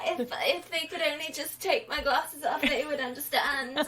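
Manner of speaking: put-on gushing as her teen self; excited.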